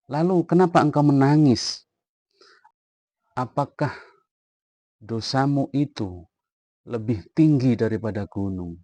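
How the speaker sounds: loud, high-pitched pressing question; insistent questioning. Retold or imitated. Rasulullah pressing the weeping youth about his sin, loud and urgent. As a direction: demand the truth from him.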